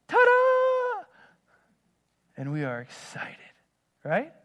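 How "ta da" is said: high, loud, drawn-out ta-da reveal; playful ta-da. Pretend. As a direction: sing out a big mock-triumphant surprise. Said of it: "and he says".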